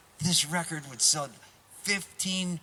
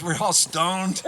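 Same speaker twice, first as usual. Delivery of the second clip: stoned drawl; 2 semitones higher, 6 dB louder, much slower than usual. Retold or imitated. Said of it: "And Butchie goes".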